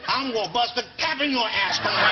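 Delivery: high, loud mock tough-guy American; bad American accent. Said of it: "give me an American accent".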